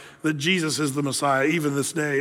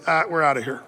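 mock dismissive quit; 3 semitones lower, faster than usual. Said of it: "left at the upper room and said".

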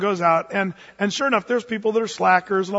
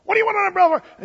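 fast, high, loud and suspicious; annoyed lady; 12 semitones higher, 5 dB louder, much faster than usual. Acted. Lady at door to the queen in disguise, fast high irritated snap. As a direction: fire the question back impatiently. Servant lady questions stranger.

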